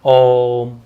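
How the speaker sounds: ओम held slowly, the o sustained like a chant; long om. Shown as a demonstration. Teacher demonstrating ओम as pluta, drawn out. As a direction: stretch the o of ओम out long. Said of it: "ऐसे, ओम".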